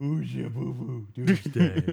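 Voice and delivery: put-on slow cooing baby talk. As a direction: croon to a little dog. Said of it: "I don't actually talk like that to an animal".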